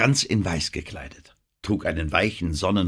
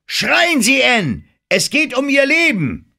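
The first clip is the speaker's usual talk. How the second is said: very high, loud commanding shout; film director bellowing the cue at full force; frantic shout; 9 dB louder than usual. Acted. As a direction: yell at her to scream for her life. Director demands the scream.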